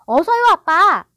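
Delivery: put-on high, loud, stretched-out child's plea; urging. A narrator's reading. John pushing his father to drink up, urging. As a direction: hurry him along as an impatient boy. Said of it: "존이 재촉했다".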